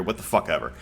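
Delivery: ordinary talk, nothing marked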